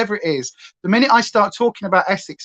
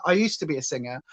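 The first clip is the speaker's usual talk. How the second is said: much softer, lower second take; soft repeat; 5 semitones lower, 7 dB quieter, faster than usual. Shown as a demonstration. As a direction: drop it to a murmur. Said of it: "repeated softer".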